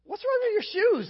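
high, sharp mock dad question; suspicious dad. Father questioning his son about the melted shoes, mock suspicion. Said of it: "And he goes".

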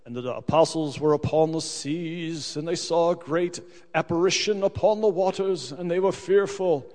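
mock grand High English reading. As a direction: intone it in stately High English. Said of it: "in the High English".

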